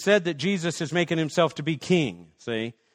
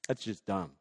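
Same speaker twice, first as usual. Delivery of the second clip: dismissive; 8 semitones lower, 12 dB quieter than usual. Acted. Acted dialogue, dismissive reply.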